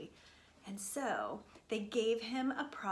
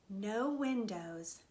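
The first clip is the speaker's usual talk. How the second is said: plain reading voice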